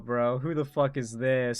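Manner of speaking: ordinary talk, nothing marked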